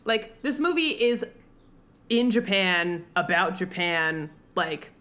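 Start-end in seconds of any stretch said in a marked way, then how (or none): none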